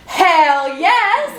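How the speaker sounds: high, loud "Hell yes!"; feisty shout. Retold or imitated. Ida answering the nurse, loud and defiant. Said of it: "and Ida goes".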